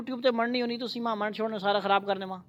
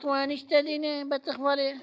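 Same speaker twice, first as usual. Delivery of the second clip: طا in a silly duck voice, slow; slow mocking duck-sounding طا; 5 semitones higher than usual. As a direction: imitate the child's duck-like طا. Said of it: "بطخ کی طرح نہیں بولنا".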